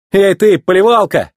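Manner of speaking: high call to get attention. A narrator's reading. McQueen hailing the fire truck, calling out. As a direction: hail someone from a distance. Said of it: "позвал он пожарную машину".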